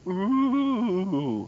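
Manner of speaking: high small imp coo; a wondering little imp ooh. Acted. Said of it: "they just sort of look up and go".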